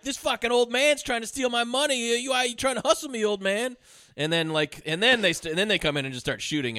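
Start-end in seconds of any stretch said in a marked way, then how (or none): 0.0-3.8 higher, fast hammed-up accusation; mock outraged gambler. Affleck accusing an old man of hustling him. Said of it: "Ben Affleck's going like".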